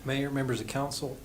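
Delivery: ordinary talk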